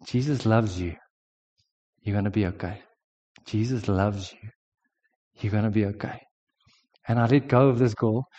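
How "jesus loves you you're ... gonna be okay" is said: soft and quiet, close to her ear; tender murmur in her ear; softly whispered. Retold or imitated. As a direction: hush it gently, a whisper of comfort. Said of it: "I whisper in her ear".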